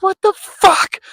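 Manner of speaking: very high, loud incredulous yelp; sudden high what-the-hell cry; shocked outcry. Retold or imitated. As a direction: shriek it in panicked disbelief. Panicking about losing the apartment.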